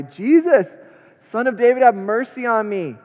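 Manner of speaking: high, loud desperate cry. Acted. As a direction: call out to Jesus across the crowd. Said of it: "he began to cry out".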